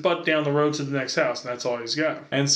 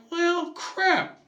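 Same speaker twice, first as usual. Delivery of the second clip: much higher, disappointed squeak; kid's letdown; 13 semitones higher than usual. Retold or imitated. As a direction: whine like a let-down kid. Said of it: "I'm like".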